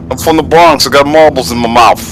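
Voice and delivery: mock Bronx accent. Clowning for friends as a Bronx native, mock New York accent. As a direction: talk Bronx through a mouthful of marbles. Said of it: "talk like you got a mouthful of marbles".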